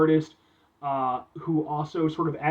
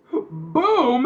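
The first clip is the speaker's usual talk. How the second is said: loud, raised boom; showy reveal; 6 semitones higher, 6 dB louder than usual. Pretend. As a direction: unveil it with a punch.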